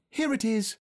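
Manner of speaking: put-on high, excited boyish exclamation; excited cry. A narrator's reading. Christopher Robin finding the present, excited cry. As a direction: call out the find, pitched well up. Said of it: "cried Christopher Robin excitedly".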